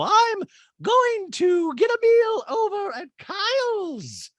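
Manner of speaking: very high, slow, loud impression; Dorit. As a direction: stretch every word grandly in falsetto. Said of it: "just so Dorit can go".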